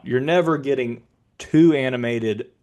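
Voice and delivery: usual speaking voice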